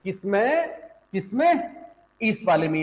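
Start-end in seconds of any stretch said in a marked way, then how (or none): none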